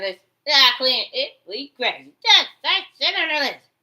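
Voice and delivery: high, loud jeering quote; mock loud taunt. Katanji taunting her, mimicked as a loud jeer. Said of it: "She said".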